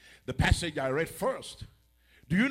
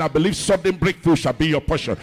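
loud, forceful pulpit declaration; louder, emphatic blessing with full force; insistent repeated prayer; 15 dB louder than usual. Real, not acted. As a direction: hammer it out so the congregation hears. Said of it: "You didn't hear my prayer".

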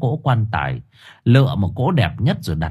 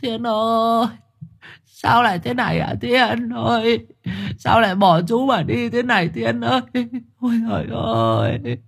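sobbing wail; 13 semitones higher than usual. A narrator's reading. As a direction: wail over the body, stretching the last words. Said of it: "Thưởng Báo ngồi xuống ôm lấy thằng Thiên mà khóc nức nở".

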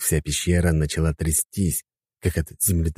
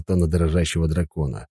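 usual speaking voice

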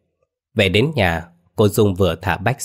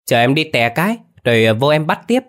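put-on lightly raised child's voice, eager; a kid blurting he needs to pee; boy rushing off to pee, raised childish voice; little boy voice; 6 semitones higher, 4 dB louder, faster than usual. A narrator's reading. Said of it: "cô cậu nói".

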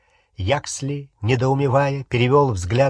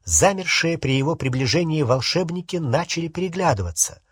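usual speaking voice

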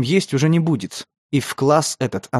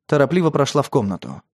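ordinary delivery

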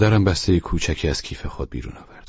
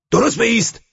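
a loud, much higher shouted command; barked order; 8 dB louder than usual. A narrator's reading. Driver shouts at the SS guard, loud and high. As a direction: bark it like a sergeant. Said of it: "فریاد زد".

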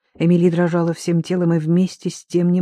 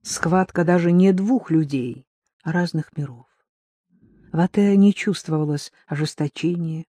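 usual speaking voice

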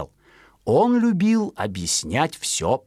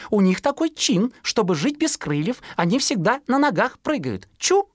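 much higher chirpy mother; high bird-mother voice; mother sparrow; 10 semitones higher than usual. Acted. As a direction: explain it high like a sparrow mum. Mother explains.

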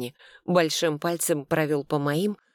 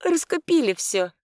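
high shopkeeper voice; 11 semitones higher than usual. A narrator's reading.

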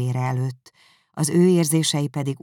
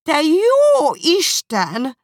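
very high, loud, slow outcry; exclaiming; 10 dB louder, much slower than usual. Acted. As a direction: shriek in dismay. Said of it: "kiáltott fel Mrs. Bennet".

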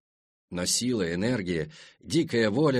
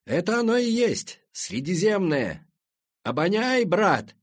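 exultant, high, raised voice; excited shout; 9 semitones higher, 4 dB louder than usual. A narrator's reading. Friend shows Semyon the Mediterranean at night, excited shout. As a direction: cry it out high and loud, drawing out the words. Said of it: "крикнул я Семёну".

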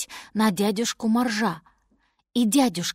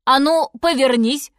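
louder, higher brisk order; commanding; 5 semitones higher, 6 dB louder than usual. A narrator's reading. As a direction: walrus giving an order. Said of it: "приказал дядюшка Морж".

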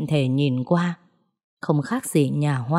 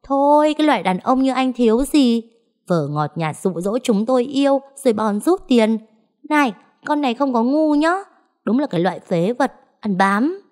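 angry scolding; 6 semitones higher than usual. A narrator's reading. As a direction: spit out the insults. Girlfriend keeps berating him. (cô bồ tiếp tục chửi mắng khinh bỉ, giọng cao)

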